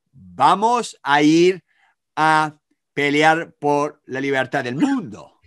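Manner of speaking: slow, weighty presidential proclamation; solemne discurso de Bush. Retold or imitated. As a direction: imitate a grand speech, word by word. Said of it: "No, dijo". (discurso solemne, lento y pausado)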